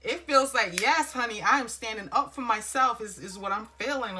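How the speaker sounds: emotional outburst. Real, not acted. Reader cheers the querent standing up for themselves, loud and emotional. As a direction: let the feeling burst out, loud. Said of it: "I get all emotional".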